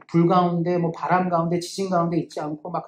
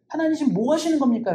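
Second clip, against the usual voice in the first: aggrieved protest; 6 semitones higher than usual. Acted. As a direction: pitch rising higher, pressing the complaint. Elijah's complaint, pressed harder.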